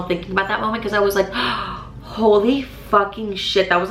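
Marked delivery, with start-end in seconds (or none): 1.3-2.0 a quiet, breathy intake of shock; soft shocked gasp. Retold or imitated. Shock at the apartment news. 2.0-3.7 drawn out slowly in awe; slow stunned disbelief. Retold or imitated. Reliving the shock.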